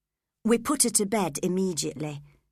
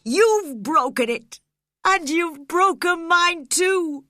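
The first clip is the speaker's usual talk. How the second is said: loud, high, slow and pained; anguished outburst; 9 semitones higher, 7 dB louder than usual. A narrator's reading. Heathcliff blaming Catherine for leaving him, anguished. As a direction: pour out the hurt and blame.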